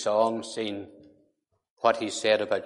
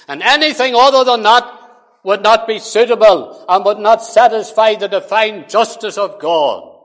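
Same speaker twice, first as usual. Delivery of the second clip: preaching at full force; 7 semitones higher, 9 dB louder than usual. Acted. Preacher to congregation, condemning the songwriter: full-force preaching. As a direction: thunder it out against the claim.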